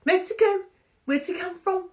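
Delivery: very high mock accent, questioning; attempted impression of James's accent. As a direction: fumble for his accent in a high voice. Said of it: "a particular accent that I can't do".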